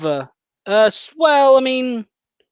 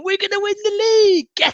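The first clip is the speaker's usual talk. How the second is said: much higher, squeaky mock-fan voice; mock Arsenal fan. Arsenal fans on Valentine's Day, high mocking voice. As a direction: ham up a deluded rival fan celebrating.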